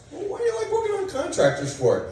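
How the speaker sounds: squeaky high-pitched doctor asking; high squeaky question; high mock doctor voice.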